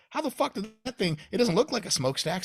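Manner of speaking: high, loud baffled outburst; mock baffled. A kid baffled by the smokestack factory, high and loud. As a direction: play dumb, squeaky and confused. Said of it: "You're like".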